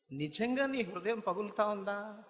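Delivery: high, loud fiery preaching; preaching at full force. Acted. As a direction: drive the rebuke home from the pulpit. Preacher voices broken heart. (ఉద్రేకంగా బిగ్గరగా ప్రసంగం)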